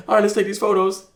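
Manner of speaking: high, lightly put-on voice of a bride keeping it together; mock composed bride. Husband imitating wife hiding tears at the wedding, high mock voice. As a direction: play her brushing it off and moving on.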